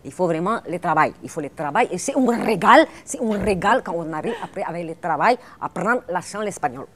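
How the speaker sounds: loud, hammed-up Spanish accent on French. Shown as a demonstration. Showing off her Spanish accent to the host, loud, hammed-up Spanish accent. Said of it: "je peux prendre un peu l'accent espagnol".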